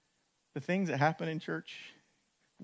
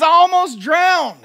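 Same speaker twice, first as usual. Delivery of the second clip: distraught; 14 semitones higher, 16 dB louder than usual. Retold or imitated. Wife tells husband she nearly drowned.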